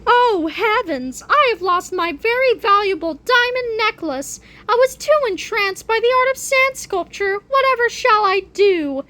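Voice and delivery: high, theatrical damsel lament; hammed-up distressed victim. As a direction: badly feign dismay over lost valuables. Feigned distress over lost necklace.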